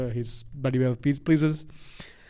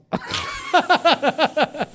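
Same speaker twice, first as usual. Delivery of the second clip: high, rapid burst of laughter; loud laugh; 4 dB louder than usual. Real, not acted. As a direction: laugh hard at your own boast. Laughs at own boast.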